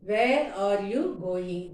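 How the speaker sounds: very slow, each word spaced out for learners; slow model sentence. Shown as a demonstration. Teacher to Tamil students, modelling the question very slowly. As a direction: spell it out slowly for the class. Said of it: "teacher models full sentence".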